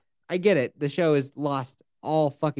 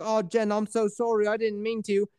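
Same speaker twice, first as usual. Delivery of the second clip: higher, softer, embarrassed; mock meek sorry; sheepish apology; 5 semitones higher, 3 dB quieter than usual. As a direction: apologize like a caught kid. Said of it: "he's like".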